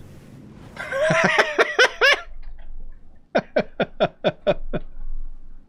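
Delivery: high breathless burst of laughter; big laugh. Real, not acted. Streamer laughing at the stupid-looking cutscene, cracking up. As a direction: crack up at how silly it looks.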